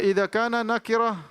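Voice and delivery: normal talking voice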